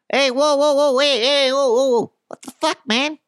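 high, loud wiseguy whoas; hammed-up gangster voice, high and loud; odd mob voice. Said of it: "I don't know what voice that was".

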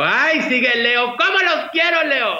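high, drawn-out gushing call to the Leo fans; gushing cry to Leo fans. Retold or imitated. Host gushing to Leo viewers, high and drawn out. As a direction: sing it out big and adoring, like on her show. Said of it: "siempre hago mucho guato y les digo".

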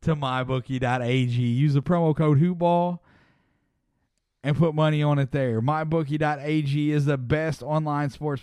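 upbeat sponsor ad read. Acted. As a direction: sell it like a radio spot. Host reads sponsor ad.

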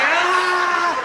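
high, drawn-out comic wail; disintegrating vampire scream. Retold or imitated. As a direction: wail like a vampire crumbling away. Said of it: "disintegrating like a vampire".

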